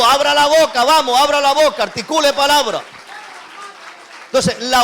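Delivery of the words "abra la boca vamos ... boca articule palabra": loud, high urging shout; preacher's shout. Shown as a demonstration. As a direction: rouse the church to open their mouths. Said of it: "Por eso le digo". (grito de predicador exhortando)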